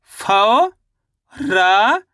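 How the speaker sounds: फौ and रा each drawn out, rising, with a gap between; syllable by syllable. Shown as a demonstration. Teacher demonstrating one syllable at a time, फौ and रा stretched apart. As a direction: sound out फौ, then रा, as separate long syllables. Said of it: "एक एक अक्षर का उच्चारण सही से".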